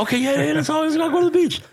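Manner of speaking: high, nervous mock yes; nervous agreeing. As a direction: squeak out a scared okay. Nervous retold reply.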